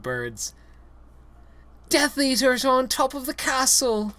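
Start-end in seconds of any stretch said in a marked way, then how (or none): 1.9-4.1 bleated. Acted. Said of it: "Ron bleated, quivering".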